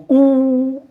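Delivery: ऊ sung out high with lips pushed round; rounded vowel demo. Shown as a demonstration. Teacher showing class lip rounding on ऊ. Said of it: "होठ गोल हो गया कि नहीं हो गया?".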